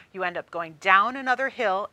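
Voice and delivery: ordinary talk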